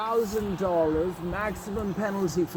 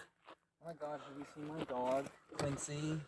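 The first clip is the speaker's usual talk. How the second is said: hushed worried; 16 dB quieter, slower than usual. Acted. Playing a lost-dog owner.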